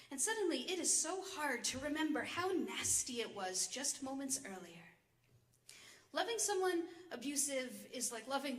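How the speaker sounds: measured spoken-word delivery with rolling, rise-and-fall tune; poem recitation. Acted. Poet reads her poem on abusive love to an audience, measured recitation. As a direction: recite it in deliberate phrases with pauses.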